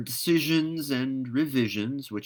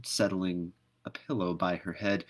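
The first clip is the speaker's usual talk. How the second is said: usual speaking voice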